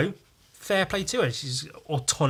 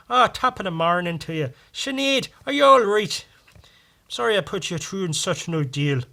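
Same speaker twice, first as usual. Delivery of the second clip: higher, lilting attempt at an Irish accent; 6 semitones higher, 4 dB louder than usual. Shown as a demonstration. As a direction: have a go at a stock Irish accent. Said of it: "the only one I can do is like".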